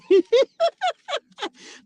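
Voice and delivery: a loud high burst of laughter. Real, not acted. Nurse laughing at her changed career plans, loud high laugh. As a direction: laugh it off loudly. Laughs after "But anyway.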